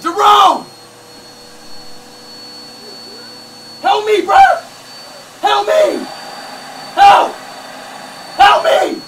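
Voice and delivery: shouted plea for help; yelling for help. Real, not acted. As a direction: holler for Jerome to come help. Yelling for his friend Jerome.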